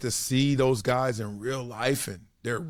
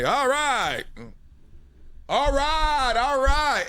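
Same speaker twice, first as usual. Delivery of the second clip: dad shouting; 9 semitones higher, much slower than usual. Retold or imitated. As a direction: burst out like a proud father. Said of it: "And then that's my dad".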